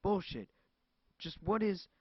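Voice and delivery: loud, high cry of fury; shout. Acted. Cassandra shouting down the phone, furious. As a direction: bark it back at him.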